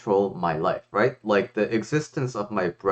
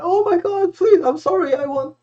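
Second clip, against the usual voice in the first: much higher, louder hammed-up pleading; mock begging; 4 dB louder than usual. Imagined sage begging the king for mercy, hammed-up high pleading. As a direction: squeal for mercy in mock panic. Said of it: "you would expect the sage to be like".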